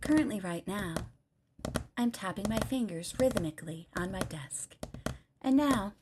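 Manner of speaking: soft, hushed radio-host murmur; ASMR. Shown as a demonstration. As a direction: talk softly and deliberately into the mic. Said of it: "I can A S M R everybody".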